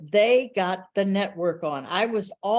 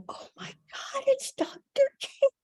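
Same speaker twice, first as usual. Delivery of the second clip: high, breathless gasp of awe; starstruck squeal; 13 semitones higher than usual. Retold or imitated. Young reporter seeing Doctor King, high awed gasp. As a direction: gush like a starstruck fan. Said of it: "And I was like".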